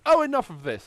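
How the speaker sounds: high, loud exasperated exclamation; angry outburst. Acted. Game master voicing Evelyn before she attacks, angry outburst. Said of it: "angrily says".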